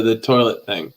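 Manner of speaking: ordinary talk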